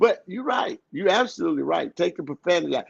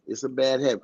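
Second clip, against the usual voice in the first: usual speaking voice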